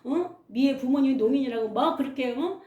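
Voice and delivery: scolding teacher. Retold or imitated. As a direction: tell him off sharply like a strict teacher. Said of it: "야단치는 거예요".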